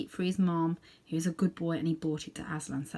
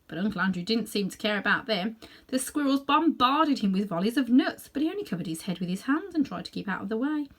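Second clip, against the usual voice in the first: plain reading voice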